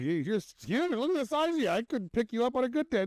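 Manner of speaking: very high-pitched mock amazement; hammed-up high voice, teasing; imitated joker. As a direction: squeal it like an astonished little guy. Mimics joking guy amazed at size.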